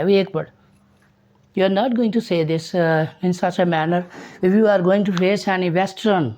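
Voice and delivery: ordinary talk, nothing marked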